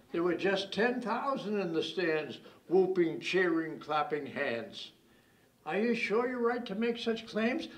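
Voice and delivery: mock interrogation in rhyming couplets; comic verse recital. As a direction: recite with a teasing, rhythmic lilt. Recites a teasing rhymed poem.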